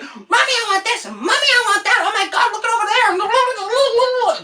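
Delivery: high, loud mock child whine; whiny kid screaming. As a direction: imitate a kid begging mommy at the cart.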